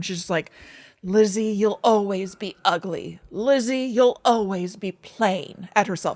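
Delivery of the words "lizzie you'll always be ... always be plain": lightly voiced old Hollywood accent; slow, affected transatlantic drawl. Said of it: "using a transatlantic accent, like Katharine Hepburn".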